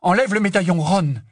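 high, loud urgent plea; strangely high voice. Acted. Hermione presses Ron to remove the locket, high-voiced. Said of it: "la voix étrangement aiguë".

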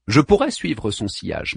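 ordinary delivery, nothing marked

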